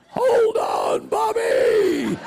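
high shouted call to hang on; cowboy hollering to the rider on the bucking horse. Retold or imitated. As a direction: holler it across the arena. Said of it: "J W Stoker's hollering".

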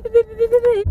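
high, loud beep beep beep like a parking sensor; sharp high electronic beeps, one after another; imitated car beeps. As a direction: chirp the beeps like the car does. Imitates car's warning beep.

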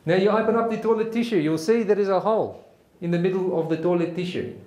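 emphatic South African military lecture; South African accent. Acted. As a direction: give step-by-step orders in a South African accent. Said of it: "try my best South African accent".

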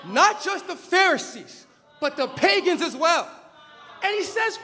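fiery preaching. Acted. Preacher thunders at his congregation, then drops to a hush. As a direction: preach it hard from the pulpit, then let it drop.

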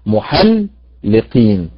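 "محلقين" slow, stressing both لـ and قـ; two stresses. Shown as a demonstration. Teacher confirms both stresses in محلقين for students. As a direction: lean on the لـ and the قـ in "محلقين". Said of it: "اللام والقاف".

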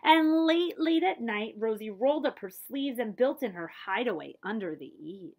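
put-on rhythmic read-aloud verse for children; story narration. A narrator's reading. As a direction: read the rhyme to a child, lilting. Reading a picture book aloud.

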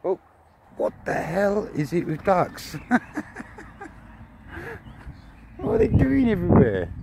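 high, loud incredulous outburst; overwhelmed by ducks, laughing; laughing disbelief. Real, not acted.

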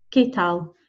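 "¿Qué tal?" with plain k, silent U; hard k sound. Shown as a demonstration. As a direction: say "Qué" as "keh" before "tal". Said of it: "Q U is a "k" sound".

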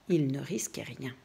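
soft unvoiced whisper. A narrator's reading. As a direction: breathe it out as a secret. Narrator whispers the line.

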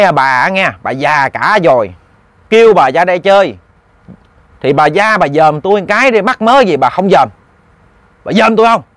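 growling, bullying ghost. Acted. Ghost demanding that Bà Tư turn and look, scolding. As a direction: badger her, with mounting insistence. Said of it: "nó gầm gừ cái giọng".

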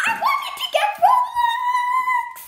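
high, loud whiny shout; frustrated shout. Real, not acted. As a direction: yell it out in frustration. Frustrated at the wheel.

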